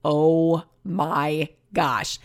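very slow, drawn-out "oh my gosh"; retold amazement. Buyer reliving hearing the basket's French story, drawn-out amazement. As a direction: stretch out each word in awe. Retelling her amazed reaction.

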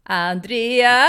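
loud, drawn-out name; excited exclamation. Real, not acted. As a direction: exclaim the name with a big lift. Exclaims co-host's name, delighted by letter. (utdraget, högljutt utrop)

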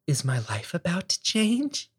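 soft, hopeful wondering, rising at the end; inner voice. Acted. Man privately wondering as he opens his email, hushed inner voice. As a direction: think it quietly to yourself, pitch climbing. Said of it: "I don't say it out loud, but in my mind".

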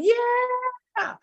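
very high, long falling yell; mock scream. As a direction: imitate a bungee jumper's drawn-out scream. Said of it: "He's screaming".

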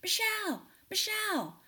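high, carrying call across a parking lot; calling out a name. Retold or imitated. Woman in the parking lot calling Michelle across the lot, high yell. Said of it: "another woman yells my name".

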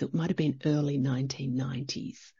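low, soft whisper. Pretend. Speaker admitting to listeners how long ago it was, whispering. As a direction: drop to a whisper, as if admitting a secret. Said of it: "I'll whisper it".

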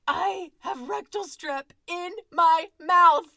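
very loud, high and slow wail of disgust; horrified shout. Retold or imitated. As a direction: howl it out in comic horror. Horreur comique, hurlé lentement.

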